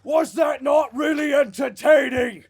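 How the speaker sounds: showman shout. Acted. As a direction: work the crowd like a wrestler. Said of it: "says to the audience".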